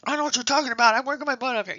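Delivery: high, loud defensive retort; mock the stressed nurse snapping back; defensive snap. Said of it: "answering in that kind of a tone".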